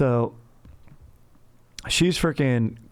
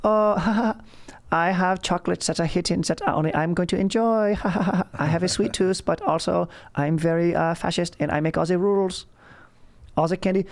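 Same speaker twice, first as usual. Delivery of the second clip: high, giggly, secretive German accent; wily German accent; 6 semitones higher than usual. Shown as a demonstration. Fascist gloating over hidden chocolates, in a wily German accent. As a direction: play a sly German with a secret, pitched up. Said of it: "German accent I can do is this sort of wily one".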